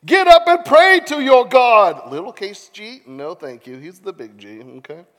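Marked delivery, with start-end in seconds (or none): 0.0-2.1 loud, high captain's command; hammed-up urgent shouting; shouted order. A narrator's reading. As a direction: rouse him with a theatrical yell. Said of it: "he shouted".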